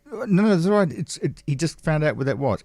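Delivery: soothing the offended crowd member; calm the crowd down; reassuring. Retold or imitated. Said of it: "you have to say".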